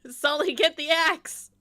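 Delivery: loud playful shout; calling out. Acted. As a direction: yell it across the boat. Calling out to party member.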